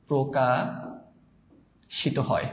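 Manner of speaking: প্রকাশিত stretched, with a long break inside the word; slow dictation. Shown as a demonstration. Teacher dictating a question to students, প্রকাশিত হয় slow and broken up. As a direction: softly draw out প্রকাশিত হয় in pieces.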